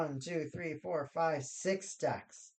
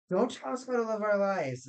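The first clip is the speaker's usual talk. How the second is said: mock defiant; 7 semitones higher, 6 dB louder than usual. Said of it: "we're like".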